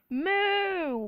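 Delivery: high, loud cow moo; storybook cow noise; bellowing moo. A narrator's reading.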